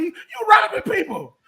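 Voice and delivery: high, drawn-out mock outrage; incredulous shout. Fan acting out being robbed by mobsters, incredulous shout. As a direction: pitch jumps up on a slow, indignant yell. Acting out a mob movie confrontation.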